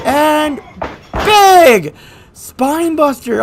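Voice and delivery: big high loud call of the move; excited shout. Acted. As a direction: hit the move name with a roar.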